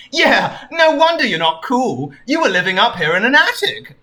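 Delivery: a hint of a cocky cartoon lecture; blunt Sonic. Sonic chides Anne Frank for hiding, lightly voiced and blunt.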